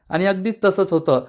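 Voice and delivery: ordinary delivery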